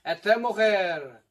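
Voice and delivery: booming show slogans, drawn out; hammed-up TV host shout; loud slogan calls.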